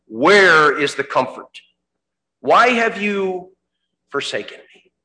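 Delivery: slow anguished cry. Acted. Jesus on the cross, slow anguished cry. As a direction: let the plea stretch, then sink. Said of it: "And Jesus cries out".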